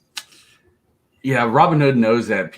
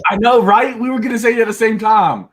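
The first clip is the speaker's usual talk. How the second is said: high, loud, gleeful outburst; real excitement, pitched way up; excited agreement; 12 semitones higher, 6 dB louder than usual. Real, not acted. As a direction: blurt it out high, thrilled at the shared idea. Gleeful agreement on shared idea.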